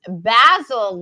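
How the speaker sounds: BASIL with a short a as in "dazzle"; English pronunciation. Retold or imitated. Reading a viewer's comment aloud, BASIL in English pronunciation. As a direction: say BASIL the British way, "BAZ-il". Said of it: "The English pronunciation".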